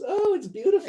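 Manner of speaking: high, loud gushing fake praise. Speaker acts out lying to a partner, gushing falsely. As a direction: coo it like an obvious, flattering fib.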